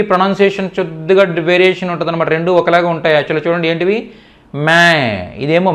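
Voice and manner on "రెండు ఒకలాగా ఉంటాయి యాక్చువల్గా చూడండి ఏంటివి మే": ordinary talk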